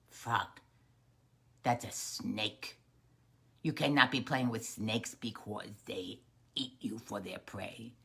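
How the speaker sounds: low, hushed warning; grave mother. Acted. Little Frog's mother warns about snakes, grave and low. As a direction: lay down the rule low and grave.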